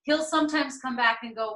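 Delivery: ordinary talk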